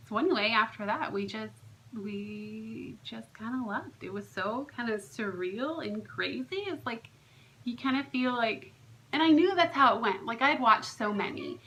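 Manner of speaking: ordinary talk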